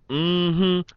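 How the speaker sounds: high imitated knowing hum. Shown as a demonstration. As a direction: demonstrate the original "Mm-hmm".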